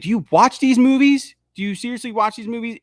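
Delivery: high-pitched, incredulous questioning; exasperated challenge. Retold or imitated. Fan answering complaints about the characters, exasperated. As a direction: challenge them in exasperated disbelief. Mock challenge to film critics.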